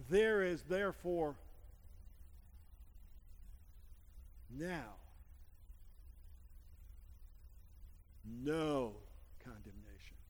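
slow, hushed, word by word with long pauses; read slowly. Retold or imitated. Pastor showing the man how to read the verse, very slow. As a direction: let every word of the verse land, slowly. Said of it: "read it again and read it slowly".

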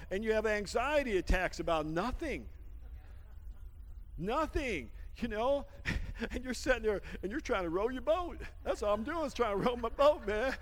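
usual speaking voice

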